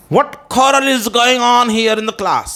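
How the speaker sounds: high, very slow scolding question, quarrel as "khorral"; teacherly exclamation. Shown as a demonstration. Teacher demanding what the class fight is, high slow exclamation. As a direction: stretch it out, pitched well up. Acts out a classroom example sentence.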